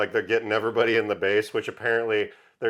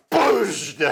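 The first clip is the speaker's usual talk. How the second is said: explosion sound; 10 semitones higher than usual. Retold or imitated.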